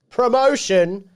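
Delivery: slow, loud, high-pitched flourish on one word; hammed-up announcement. Host introducing a friend's clip, hammed-up announcement. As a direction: draw it out grandly like a mock announcer. Grandly finishing the clip's introduction.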